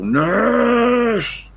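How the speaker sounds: huge high bellow calling the nurse; old man bawling for the nurse; foghorn shout. Retold or imitated. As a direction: bellow it down the ward, very high and loud. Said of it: "as usual".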